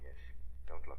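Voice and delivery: low hushed whisper; whispered warning. Acted. Hero whispering to Margot beside the body, low and hushed. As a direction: spare her the sight. Hero shields woman from corpse.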